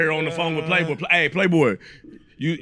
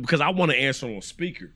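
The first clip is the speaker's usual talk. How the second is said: ordinary talk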